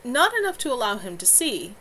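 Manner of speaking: ordinary delivery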